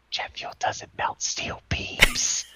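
slow mock-conspiracy whisper; whisper in her ear. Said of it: "get real close to her ear".

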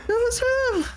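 high-pitched accusing heckle; anonymous crowd voice. Pretend. Someone in the crowd points blame, high heckle. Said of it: "Like the person in the crowd".

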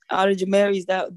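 ordinary delivery

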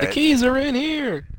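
high, drawn-out comic cry; hammed-up high-pitched wail; mock panic. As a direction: imitate a trapped man calling out, slowly. Co-host echoes the panicked line.

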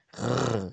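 rolled animal growl. Acted. Tiger girl about to say no, growling.

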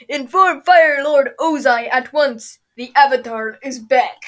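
slow, loud dopey voice. Retold or imitated. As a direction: dumb guard voice, dragged out. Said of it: "Dopey voice".

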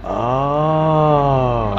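long drawn-out "ohhh"; imitating someone catching on; knowing "oh". Repeats the guy's knowing reaction.

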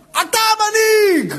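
high, drawn-out acclamation; triumphant proclamation. Acted. Preacher acclaims the man who carried the kid, triumphant proclamation. Acclaiming the chosen leader, voiced.

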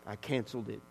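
low, very soft acted reply; subdued admission. Woman answering pastor about her breakdown, low and subdued. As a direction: drop it quietly after a pause.

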